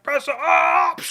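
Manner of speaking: loud, high battle yell; orc war cry. Retold or imitated. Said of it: "So orcs are like".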